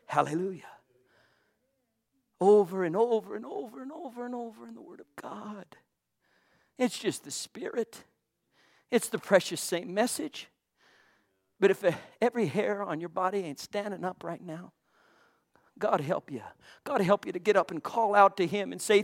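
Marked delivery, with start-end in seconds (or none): 0.1-0.7 a hushed, private hallelujah to God; whispered hallelujah. Real, not acted. Preacher breathes a soft praise. 15.8-16.4 hushed warning. Real, not acted. Preacher drops into a hushed warning.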